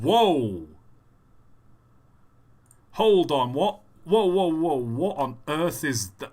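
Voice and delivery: real astonishment, firing off stunned questions; shocked disbelief. Real, not acted. Streamer to a player who just caught something bizarre, shocked disbelief. Startled by a catch in game.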